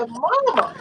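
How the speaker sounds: calling "Mama", second one leaping very high; imitated screaming. Mother re-enacting a dying man's plea, screaming. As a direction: re-enact a desperate cry for mother, rising to a high wail. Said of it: "hear him screaming".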